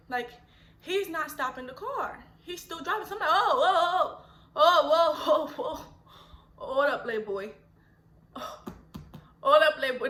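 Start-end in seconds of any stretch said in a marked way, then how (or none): 3.3-10.1 alarmed whoa. Retold or imitated. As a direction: hit the brakes with your voice. Alarm at driver not stopping.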